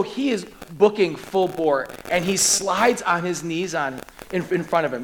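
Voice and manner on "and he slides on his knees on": normal talking voice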